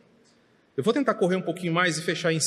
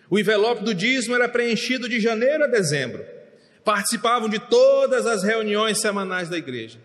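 raised, higher pulpit voice; pregação enfática; 5 semitones higher, 4 dB louder than usual. Real, not acted. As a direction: drive each item home from the pulpit. Preacher lists their deeds. (pregação em tom elevado)

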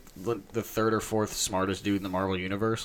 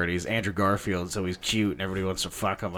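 usual speaking voice

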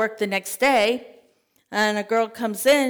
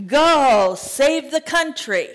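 a loud, rousing charge; loud command; 4 semitones higher, 6 dB louder, slower than usual. Acted. God charging Gideon to go, loud rousing command. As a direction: send him out like a commander.

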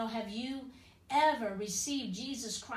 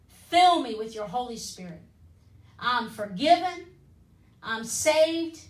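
led prayer; 4 dB louder than usual. Acted. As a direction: pray it aloud with long pauses so they can follow. Leads the salvation prayer aloud.

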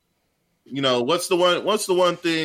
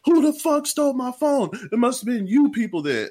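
retold angry yell; 10 semitones higher than usual. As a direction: yell at the whole lobby. Angry customer accusing, retold.

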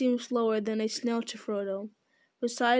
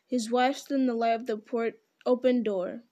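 ordinary delivery

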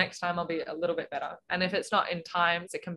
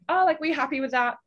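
higher-pitched, lightly voiced coach question; imitated coach; 8 semitones higher than usual. Coach asking a swimmer after a race, imitated upbeat query. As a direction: play the coach checking in, pitched up.

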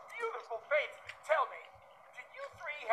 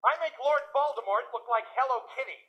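ordinary delivery

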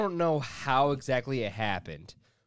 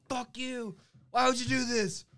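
high, strained mock yelling; retold yelling; 10 semitones higher than usual. Retelling how he yelled at Brandon over girlfriend, high angry rant. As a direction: act out an angry rant, pitched up. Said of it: "I just keep on yelling".

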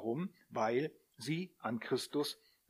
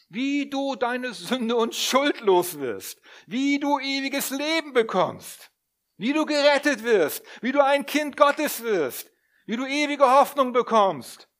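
loud, high, slow sermon climax; preaching at full force; 9 semitones higher, 10 dB louder than usual. Real, not acted. Preacher rallying the congregation, loud and soaring. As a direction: build it up, proclaim every line.

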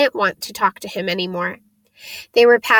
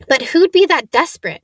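wondering aloud; 6 semitones higher, 5 dB louder, faster than usual. A narrator's reading. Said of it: "he wondered aloud".

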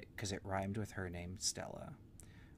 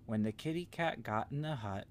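ordinary delivery

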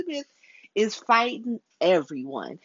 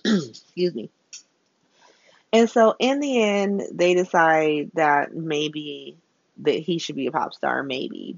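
ordinary talk

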